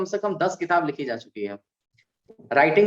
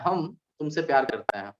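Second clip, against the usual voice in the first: हम, then a clear pause before तुमसे; pause after हम; 5 dB quieter than usual. Shown as a demonstration. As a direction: break the line right after हम. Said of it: "हम के बाद अगर हम कॉमा लगाते हैं".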